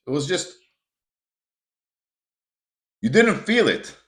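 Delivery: loud, exasperated lecture on rushing; heated rant. Real, not acted. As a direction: preach it hard at the listener, exasperated. Keeps ranting about wolfing food.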